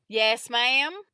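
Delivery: courteous Texan answering a lady; Texas drawl. A narrator's reading. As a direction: lift it into a Texas twang. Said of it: "in a rather pronounced Texas drawl".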